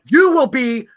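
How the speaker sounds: very loud, high mock scolding; mom yelling. Mom yelling at her kid, loud angry voice. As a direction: imitate an angry mother at full volume. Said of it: "like when your mom was yelling at you".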